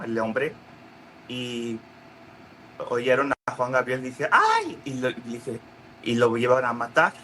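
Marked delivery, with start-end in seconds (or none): 4.3-4.8 a sudden frightened yelp; mock scream. [name] overheard by gunmen, high scream.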